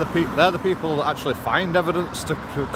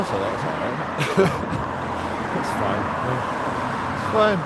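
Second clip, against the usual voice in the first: slow, dry sarcastic aside; 4 semitones lower, much slower than usual. Real, not acted. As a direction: drawl it with irony. Sarcastic police remark.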